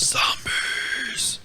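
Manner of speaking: drawn-out breathy whisper; dramatic whisper. Pretend. Co-host echoing the zombie hunter, mock-dramatic whisper. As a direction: whisper the word like a horror trailer.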